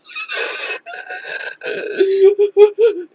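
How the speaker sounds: a high crying laugh breaking out; tearful laugh. Real, not acted. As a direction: laugh and cry at once. Laughing through tears over grief.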